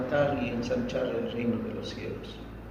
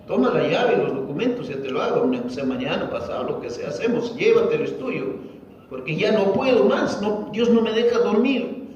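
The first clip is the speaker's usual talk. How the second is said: louder, higher retold urging; insistencia desesperada; 6 semitones higher, 3 dB louder than usual. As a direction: press the gift on someone, pleading loudly. Pastor retells man insisting on gift. (insistencia apremiante al relatar)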